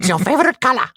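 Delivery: high, hammed-up questioning; mock interrogator. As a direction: deliver it as an absurd interrogation question. Mock interrogation, comic quote.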